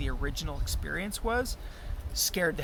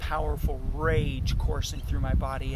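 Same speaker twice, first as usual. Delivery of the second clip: ordinary delivery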